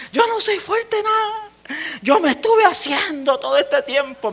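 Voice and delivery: high, slow tearful wail; crying mother. Retold or imitated. Mother at husband's burial, crying to her son. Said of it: "me gritaba".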